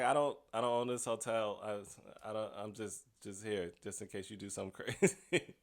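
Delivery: softly imitating the nervous lady; timid hotel lady. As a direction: shrink away, barely audible and unsure. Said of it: "The lady was like".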